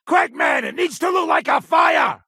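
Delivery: high, loud hurried order; urgent shout. Acted. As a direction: push him to hurry. Urgent order to set fire.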